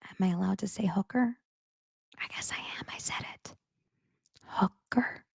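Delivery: unvoiced whisper of a naughty word. Real, not acted. Whispering to listeners before saying a rude word. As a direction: breathe it like a secret. Unsure if she can say it.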